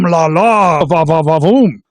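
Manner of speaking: playful mock-French exclamation; lightly put-on French flourish. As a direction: drop into a hint of hammed-up French. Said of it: "I'm speaking French about pizza".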